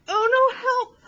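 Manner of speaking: panicked cry for help. Acted. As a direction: call for help in a fright. Elephant panics about the mouse.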